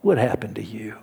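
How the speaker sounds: a hushed, pitying aside; Pharaoh's thought. Retold or imitated. Pharaoh privately pities Moses, low and hushed. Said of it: "Pharaoh would think to himself".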